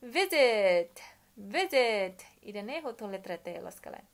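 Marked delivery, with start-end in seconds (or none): 0.0-2.3 "Visit" called out loud and clear, falling; loud model word. Shown as a demonstration. Teacher presenting the verb "visit" loudly to students. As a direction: announce "visit" loudly to the class. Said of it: "Verbo dene".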